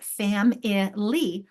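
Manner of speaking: "Family" drawn out slowly as FAM-ih-LEE; syllable by syllable. Shown as a demonstration. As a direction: break "Family" into three separate syllables. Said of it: "there are three syllables".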